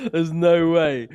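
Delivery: loud, high laughing disbelief. Real, not acted. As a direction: cry out in amused disbelief. Laughing at the costume.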